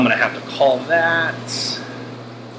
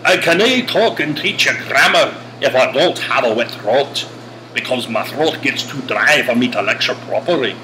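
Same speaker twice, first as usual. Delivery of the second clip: committed Scottish brogue with broad vowels; decent Scottish brogue. Pretend. Teacher explaining a dry throat to students, Scottish brogue. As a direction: put on a convincing Scottish accent. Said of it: "that's a decent Scottish brogue".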